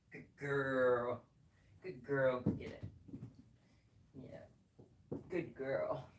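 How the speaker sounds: gentle pet praise; soft praise to a pet. Real, not acted.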